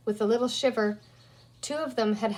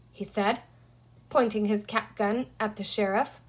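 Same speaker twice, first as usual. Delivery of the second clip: ordinary delivery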